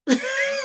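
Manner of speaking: laugh. Real, not acted. Laughs at her own rant.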